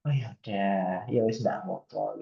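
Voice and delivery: mild Javanese ya wis shrug, voice dropped; relenting Javanese way. Retold or imitated. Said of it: "Jadi kayak saya yang mana kayak".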